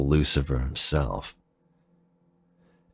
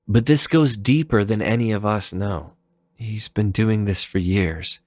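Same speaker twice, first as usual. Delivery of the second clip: put-on a raised, earnest young woman's voice telling a grim truth; CL explaining; 5 semitones higher than usual. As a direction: lift the pitch and confide the dark news. Character explains Reploid killings.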